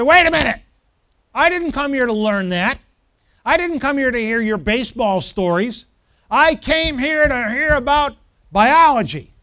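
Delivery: acting out a fed-up student's outburst; angry protest. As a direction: raise your voice and object hotly. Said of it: "You're gonna get real angry".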